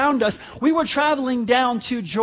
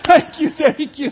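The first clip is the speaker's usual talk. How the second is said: loud, high, slow mock praise; hammed-up grateful cry; praising God; 5 semitones higher, 8 dB louder than usual. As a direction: imitate relieved onlookers giving thanks. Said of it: "they were, like, praising God".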